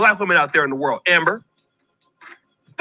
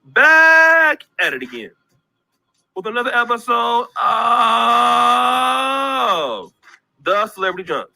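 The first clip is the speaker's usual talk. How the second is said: slow, stretched announcer sign-off; announcer intro; 2 semitones lower, much slower than usual. Acted. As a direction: draw out the name like a hype host. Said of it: "signature show intro".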